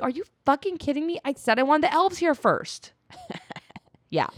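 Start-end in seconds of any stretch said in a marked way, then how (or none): 0.0-2.9 raised, quick mock fury; a hint of Eru fuming; mock outrage. Eru scolding Ole, lightly mock-angry. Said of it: "He was like".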